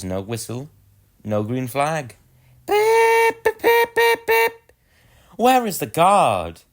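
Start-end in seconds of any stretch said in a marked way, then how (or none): 2.7-4.7 very high, loud repeated toots; impatient whistle beeps. Acted. 5.4-6.6 higher, louder, slow worried question; anxious engine. Acted. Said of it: "Edward was getting anxious".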